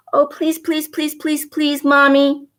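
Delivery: begging. Acted. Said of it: "Cassie said".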